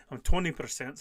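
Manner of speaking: usual speaking voice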